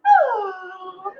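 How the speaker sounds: high, refined little moan; a graceful, restrained oh of pleasure; elegant moan. Acted. Performer demonstrating the elegant moan, high and refined. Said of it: "The elegant moan".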